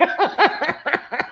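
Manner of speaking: long, high-pitched cackle. Real, not acted. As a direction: break up in high laughter. Laughs about creepy dolls.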